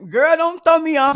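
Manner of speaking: loud teasing scold; mock-stern scold; playful scolding. Real, not acted.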